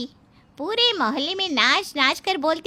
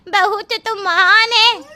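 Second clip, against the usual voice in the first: higher and louder, full of delight; gushing praise; 7 semitones higher, 6 dB louder than usual. Acted. Neighbour gushing to the daughter-in-law, high and excited. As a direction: burst out with thrilled praise.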